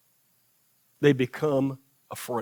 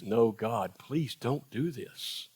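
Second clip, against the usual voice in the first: slow, soft, low plea; gentle plea; 4 semitones lower, 7 dB quieter, slower than usual. Retold or imitated. Moses quietly begs God not to destroy them, slow and soft. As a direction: ask it quietly and slowly, gently.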